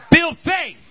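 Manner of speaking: high, shouted pulpit exhortation; full-force preaching. Real, not acted. Preacher urging people to build faith in the fearful, full-force shouted preaching. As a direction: cry it out to rouse the whole church.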